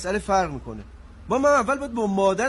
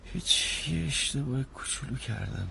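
sheepish low confession; 13 semitones lower, 10 dB quieter than usual. Acted.

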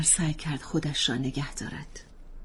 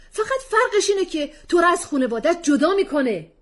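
a hint of a begging husband, raised; pleading; 14 semitones higher, 9 dB louder than usual. Husband begging wife to be reasonable, pleading. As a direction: plead with her to be sensible. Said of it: "لحنش ملتمسانه بود".

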